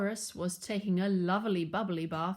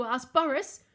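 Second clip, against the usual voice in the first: usual speaking voice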